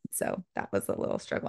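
ordinary talk, nothing marked

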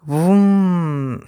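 long, slow humming voom; drawn-out "voom". A narrator's reading. Boy showing off his glasses, long drawn-out voom. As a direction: stretch the noise right out.

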